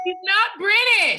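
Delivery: slowly and loudly insist on it; emphatic contradiction. Real, not acted. Heated back-and-forth contradiction.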